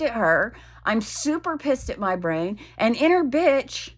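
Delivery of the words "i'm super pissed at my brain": ordinary talk, nothing marked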